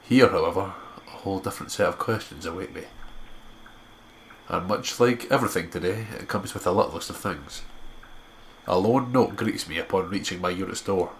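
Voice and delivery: steady storyteller read; story narration. Acted. Narrating the walk back to school, even storyteller tone. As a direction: carry the listener through the scene. Reads visual novel narration.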